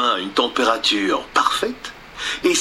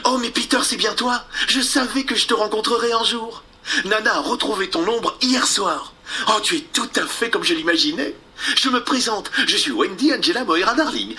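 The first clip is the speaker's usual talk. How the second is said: voix de fillette, plus aiguë; fillette émerveillée; 8 semitones higher than usual. Acted. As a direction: une fille ravie de le rencontrer. Wendy rencontre Peter. (Wendy émerveillée)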